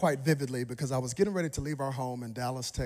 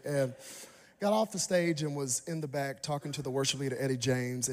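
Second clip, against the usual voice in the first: normal talking voice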